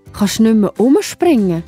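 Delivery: plain reading voice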